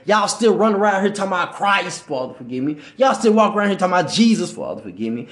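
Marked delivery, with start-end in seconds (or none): 2.0-2.8 hushed sidelong plea under the breath; soft penitent mutter, as if excusing himself; quiet aside prayer. Acted. Muttered mock prayer after saying names. 4.5-5.3 hushed sidelong plea under the breath; soft penitent mutter, as if excusing himself; quiet aside prayer. Acted. Muttered mock prayer after saying names.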